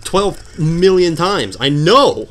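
loud, fed-up retort with stretched emphasis; exasperated. Real, not acted. Player fed up at being told it's Bashi time, exasperated. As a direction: build to an emphatic, irritated finish. Snaps back at repeated game announcement.

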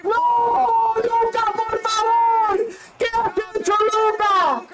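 high, stunned outcry; dismayed cry. Real, not acted. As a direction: cry out in disbelief. Fan seeing a missed chance.

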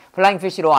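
"Flying fish roll" evenly in Korean syllables, no stresses; no accent, Korean style. Shown as a demonstration. Teacher rejecting the Korean way, said unaccented. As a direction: run Flying fish roll together without any beats. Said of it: "한국 사람들은 여기다 액센트 안 넣어요".

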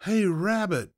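high, loud call from outside; yelled call. A narrator's reading. Someone outside calling at the fox's door, yelling. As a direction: holler it up to reach indoors. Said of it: "someone yelled from outside".

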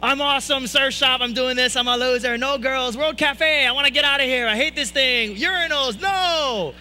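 high, loud hammed-up meltdown; angry venting. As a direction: dump everything out in one yelled, hysterical stream. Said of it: "I just dump it all on him".

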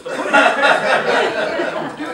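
mock boss report while laughing. Real, not acted.

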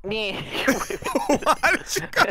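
words drawn out through laughter; laughing. Real, not acted. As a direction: ask it while cracking up. Laughing at teammate's wrong turn.

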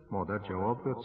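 usual speaking voice

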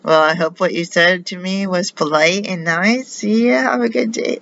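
high, sugary, looking-down voice; mock-sweet goodbye from a haughty churchgoer; condescending spoiled daughter. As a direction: mimic a fake-polite brush-off, pitched high. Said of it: "looking down at me, like".

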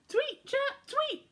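very high chirpy bird tweets; birdsong imitation. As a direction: chirp like a noisy little bird. Noisy birds.

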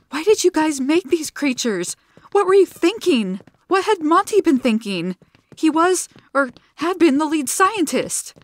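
high, loud accusing questions; angry demand. A narrator's reading. Zilla confronting the scientist, angry. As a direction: confront him angrily.

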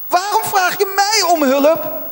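high-pitched, stunned echo of the question; incredulous outcry. Acted. Preacher reacting to God's answer to Moses, incredulous. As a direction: throw the question back in disbelief.

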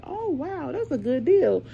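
high, delighted gasp at a bargain; excited. Retold or imitated. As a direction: squeal it like a great find. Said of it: "So I was like".

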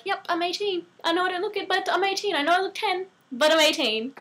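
high, soft put-on kid voice; little kid voice. Showing ID to a casino bouncer, little kid voice. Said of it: "put in my little kid voice".

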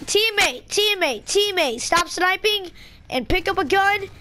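exasperated. Real, not acted. Scolding teammates.

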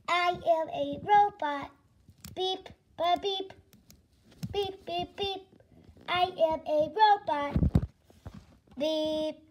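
slow monotone robot with beeps; robotic, word by word. Acted. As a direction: drone it out evenly like a machine.

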